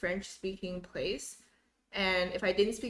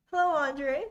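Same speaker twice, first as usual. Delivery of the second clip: ordinary talk, nothing marked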